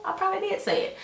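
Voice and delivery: high, coy mock voice; mimicking evasive denial. Imitates Miss Marla dodging.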